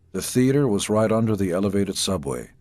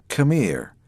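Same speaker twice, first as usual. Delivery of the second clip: "Come here" pitched higher with a menacing edge on "Come"; threatening come here; 6 semitones higher, 3 dB louder than usual. Retold or imitated. A bully calling a kid over, menacing. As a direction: warn that a beating is coming. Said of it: "I'm going to kick your ass".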